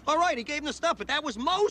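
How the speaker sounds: high, agitated comeback; defensive, high-pitched retort; exasperated concession. Acted. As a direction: concede it impatiently, still arguing.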